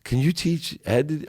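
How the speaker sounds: lit up. Retold or imitated. Wife lights up asking for teaching, retold excited. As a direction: imitate someone lighting up with interest. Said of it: "And she lit up".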